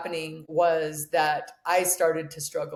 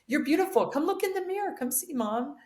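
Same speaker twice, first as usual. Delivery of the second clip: lightly voiced small boy, pitched higher; little son comforting mom; 10 semitones higher than usual. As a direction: sweetly coax mom like an eight-year-old. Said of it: "He goes".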